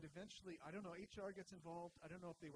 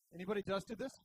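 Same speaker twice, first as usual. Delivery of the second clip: a loud, brash joke line; loud mock-detective quip; 3 semitones higher, 14 dB louder than usual. Dick joking to grieving coworkers, loud and brash. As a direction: bark it out loud like a cop on the case. Dick's joke, holding a donut.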